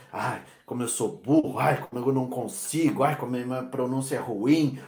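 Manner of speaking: low, soft mock whining; a hint of a self-pitying learner. Learner moaning about own pronunciation, low mock whine. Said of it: "você é o seu pior inimigo".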